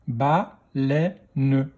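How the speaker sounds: "Baleine" split into ba, lè, ne; "Baleine" in three separate beats; syllable by syllable. Shown as a demonstration. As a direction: sound out "Baleine" with a final ne. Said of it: "Deuxième syllabe".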